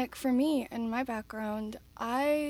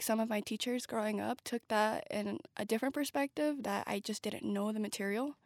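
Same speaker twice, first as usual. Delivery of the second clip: ordinary delivery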